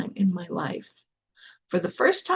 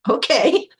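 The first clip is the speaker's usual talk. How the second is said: high, loud, rising agreement, acted out; high-pitched okay; 8 semitones higher, 6 dB louder than usual. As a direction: play trusting compliance, pitched way up. Retold meek reply to sponsor.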